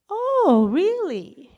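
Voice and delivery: high, swooping incredulous "oh, really?"; retold pointed disbelief; taken aback. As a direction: start high and loud, then come down sceptically. Reacting to women being silenced.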